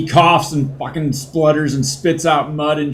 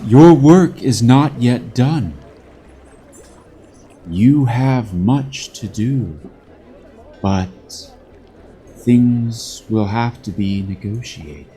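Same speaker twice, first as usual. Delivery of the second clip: spirit voice; 3 semitones lower than usual. Acted. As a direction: speak as the unseen spirit. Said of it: "Comes back and says".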